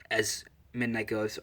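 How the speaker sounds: plain reading voice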